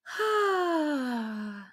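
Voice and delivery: long relaxed sigh of comfort; a happy, drawn-out sigh; contented sigh. Acted. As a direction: sink into the couch with a sigh.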